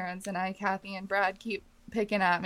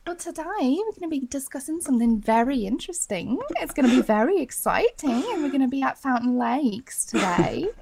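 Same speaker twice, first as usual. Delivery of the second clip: exaggerated, higher-pitched Aussie accent; Australian accent; 5 semitones higher, 7 dB louder than usual. Shown as a demonstration. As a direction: parody a Kath and Kim Australian. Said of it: "Kath and Kim Australian accent".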